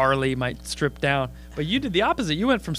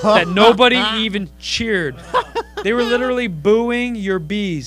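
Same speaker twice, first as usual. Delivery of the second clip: slow, louder mocking punchline; roast; 6 semitones higher, 8 dB louder, slower than usual. Comic roasting the topless heckler, slow mock. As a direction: lay the burn on thick. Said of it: "roasting the heckler".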